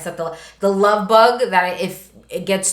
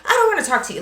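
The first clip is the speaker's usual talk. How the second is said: high-pitched mock avoidance; fleeing refusal; 8 semitones higher, much faster than usual. Son running away from the sweater, panicky refusal. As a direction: push it away in a raised pitch. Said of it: "the guy's, like, running away".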